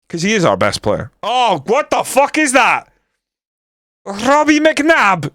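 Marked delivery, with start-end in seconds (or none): 1.2-5.3 high, drawn-out cry of outrage; real disbelief. Real, not acted. Player reacting to Robbie McNabb in the game, outraged disbelief. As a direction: protest it slowly, pitched way up.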